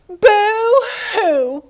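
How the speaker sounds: high playful boo-hoo; teacher voicing Little Beaver's cry; mock crying. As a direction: fake a child's cry. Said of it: "he said".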